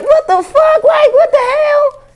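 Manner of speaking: high shocked yelp. Retold or imitated. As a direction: yelp in disbelief at the screen. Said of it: "I'm like".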